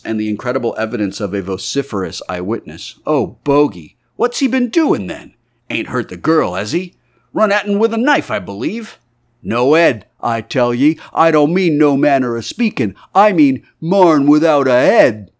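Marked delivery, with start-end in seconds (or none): 3.1-9.0 a higher, louder gossiping rustic voice; excited villager. Acted. Villager asks eagerly about stranger. 9.4-15.3 a loud, high, rustic insistence; insistent eyewitness. Acted.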